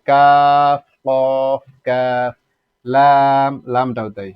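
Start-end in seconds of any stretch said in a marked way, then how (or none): 0.1-2.3 কাফ, কফ each with a long held vowel; four-beat vowels in কাফ, কফ; elongated vowel. Shown as a demonstration. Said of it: "চার আলিফ পরিমাণ টানতে হবে". 2.9-3.5 লাম with a long, loud held aa; loud drawn-out লাম; elongated vowel. Shown as a demonstration. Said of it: "এভাবে".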